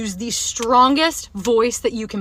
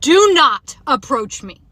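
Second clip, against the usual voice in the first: loud, slow, firm command; stern slow shout of do not approach; retold yell; 4 semitones higher, 7 dB louder, slower than usual. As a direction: yell it to make him back off. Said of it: "I literally yelled".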